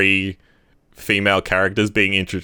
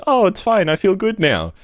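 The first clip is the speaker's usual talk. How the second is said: high airy mock cheer; mock relieved; 10 semitones higher than usual. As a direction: toss it off like all is fixed. Mocking characters' easy resolution.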